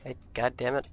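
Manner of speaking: ordinary talk, nothing marked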